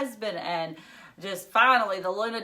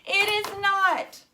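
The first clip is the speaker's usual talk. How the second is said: put-on much higher, louder upset girl; a furious young girl, much higher and louder; upset girl shouting; 12 semitones higher, 6 dB louder, slower than usual. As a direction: rant at her father, near tears. Phoebe insists about mom.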